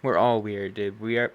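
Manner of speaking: normal talking voice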